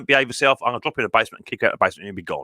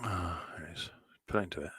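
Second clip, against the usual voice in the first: breathy whisper, barely audible, said to himself; half-whispered note to self; whispered aside; 7 semitones lower, 18 dB quieter than usual. Real, not acted. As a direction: drop to a private whisper while busy with files. Muttering to himself while sorting files.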